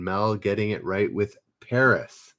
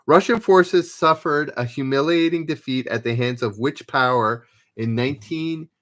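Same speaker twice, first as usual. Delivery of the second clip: louder, announcing quiz question; quiz host read; 3 semitones higher, 6 dB louder than usual. Acted. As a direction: read the question out to the players like a quizmaster. Reads quiz question aloud.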